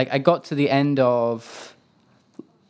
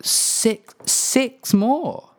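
high, disbelieving "six more?"; incredulous; 11 semitones higher, much slower than usual. Retold or imitated. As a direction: squeak it out in disbelief. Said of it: "And I was like".

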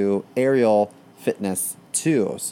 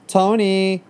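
high call; 9 semitones higher, 3 dB louder than usual. Acted. Calling out to Tony.

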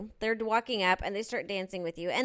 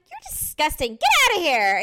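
higher, much louder, disgusted shout; disgusted yell; 7 semitones higher, 10 dB louder than usual. Retold or imitated. Woman yelling at man who bumped her, disgusted shout. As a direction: yell him off the dance floor. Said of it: "I just yelled at him".

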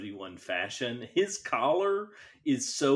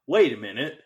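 surprised; 4 semitones higher, 6 dB louder than usual. Retold or imitated. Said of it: "Peter Cushing's like".

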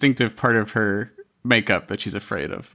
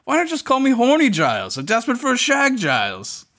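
high, indignant mock outburst; Spike; 12 semitones higher than usual. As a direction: imitate his outrage, pitch soaring on the insults. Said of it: "later from Spike also, when he finds out his name".

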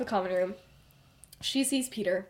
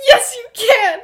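much louder and higher, laughing; hammed-up yell pushing back; loud laughing insistence; mock shouted insistence; 13 semitones higher, 14 dB louder, slower than usual. Heated argument between friends.

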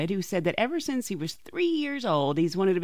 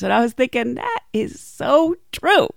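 ordinary talk, nothing marked

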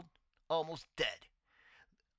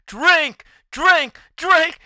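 higher, louder chant of a temptation; tempting beer voice; 8 semitones higher, 9 dB louder, much slower than usual. Retold or imitated. Beer calling the young drinker, higher, louder chant. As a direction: lure him like the beer is calling. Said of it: "coming out of the beer and say".

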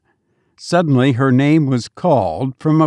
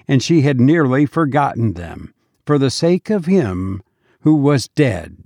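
ordinary delivery, nothing marked

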